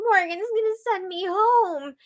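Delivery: high, teary mock wail; retold crying in a high voice; imitating crying. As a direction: play it as a lightly faked sob. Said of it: "again, is crying and she says".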